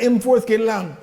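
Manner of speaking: loud mocking patois jeer; a patois jab at a giant; Jamaican patois taunt. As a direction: taunt him in broad patois. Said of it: "what he really said in Jamaican".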